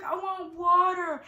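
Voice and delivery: high, loud, drawn-out wail; acted-out laboring woman's needy cry; whining demand. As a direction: whine for it, dragging the words out. Acts out demanding water in labor.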